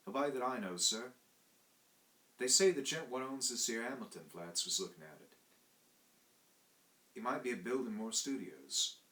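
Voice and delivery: Cockney servant. Acted. Thomas answering his master's question, Cockney and deferential. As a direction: speak up like a deferential London servant.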